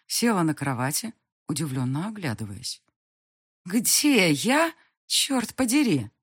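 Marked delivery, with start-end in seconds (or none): 3.7-6.1 put-on higher, slow, crude young woman; rough-mouthed girl. A narrator's reading. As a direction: demand it like a brash girl. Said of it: "произнесла она".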